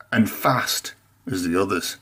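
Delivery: ordinary delivery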